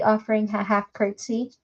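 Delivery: ordinary delivery, nothing marked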